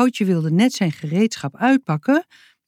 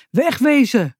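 shouting man; 7 semitones higher than usual. Acted. Angry man shouting at the door to leave. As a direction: bark it out at the door. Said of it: "riep een man".

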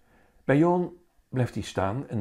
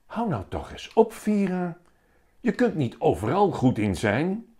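higher, mildly annoyed grumble; grumbling; 9 semitones higher, 4 dB louder than usual. A narrator's reading. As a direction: tell her off, half irritated. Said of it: "Meester Jaap kijkt op en moppert". (mopperend, hoger en licht geïrriteerd)

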